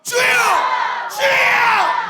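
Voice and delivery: very high, very loud shout; leading the crowd in a loud cry; full-force cry. Shown as a demonstration. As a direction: shout it with everything. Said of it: "다 같이 시작".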